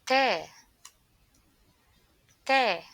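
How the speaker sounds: loud clear Ta; clear teaching model. Shown as a demonstration. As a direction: model Ta for learners. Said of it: "Repeat after me".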